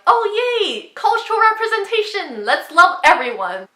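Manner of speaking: high, loud hammed-up cheer; imitating an overjoyed author; mock enthusiasm. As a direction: gush with fake delight, loudly. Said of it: "Ryan is probably like".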